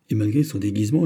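usual speaking voice

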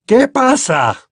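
high-pitched irate demand; indignant, tense question; angry growl. A narrator's reading. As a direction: demand an answer like a cross uncle. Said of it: "gruñó tío Vernon".

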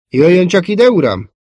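high, loud summons, dropping on the last word; calling over. A narrator's reading. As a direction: call your officer over to look. A soldier calls the officer over. (hangosan odahívva)

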